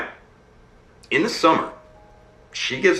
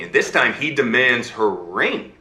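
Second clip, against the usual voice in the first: plain reading voice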